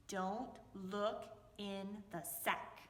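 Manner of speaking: drawn out slowly and low; slow warning. Acted. Foxy warning the woman about his sack, slow and stern. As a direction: stretch each word as a stern warning.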